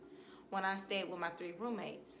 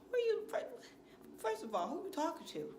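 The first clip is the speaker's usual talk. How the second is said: much higher squeak of indignation; high indignant retort; 8 semitones higher than usual. Retold or imitated. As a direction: fire it back, shocked and indignant. Acted confrontation with stalker.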